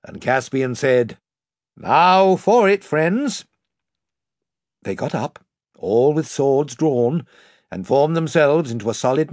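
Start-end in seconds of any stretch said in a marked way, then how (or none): 1.8-3.5 loud, slow battle rally; rally the troops; rallying call. A narrator's reading. King rallies before battle.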